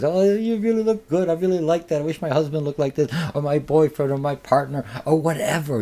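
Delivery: butter up the customer like a chatty stylist; flattering hairdresser. Acted.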